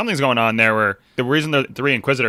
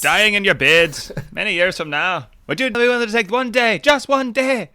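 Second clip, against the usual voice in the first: put-on Scottish accent; 9 semitones higher than usual. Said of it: "Is that your Scottish accent?".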